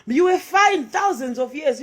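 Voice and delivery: a rising protest shout; loud outburst. Real, not acted. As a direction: throw it out at the crowd. Outburst against colonial religion.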